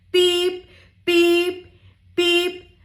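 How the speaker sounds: high, loud, evenly held beeps; cheery truck honking on one high note; truck horn beeps. Acted. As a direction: toot it like a little truck horn.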